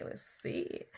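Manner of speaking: barely audible whisper; whispered to self. Real, not acted. Reader searching the letters for words, whispered to self. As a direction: think aloud under your breath, unvoiced.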